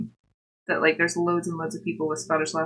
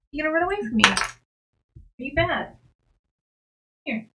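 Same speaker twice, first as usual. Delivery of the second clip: high pet-talk voice; 8 semitones higher, faster than usual. Real, not acted. Streamer asking the cat if she will run away, high pet talk. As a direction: tease an animal in a higher voice.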